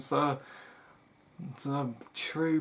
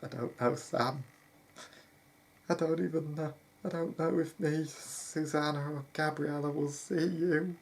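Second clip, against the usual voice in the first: voice starting to crack; 4 semitones higher than usual. Acted. Brother parting from Sam, unsure they will meet again, voice cracking. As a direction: let the voice begin to give way. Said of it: "his voice began to crack".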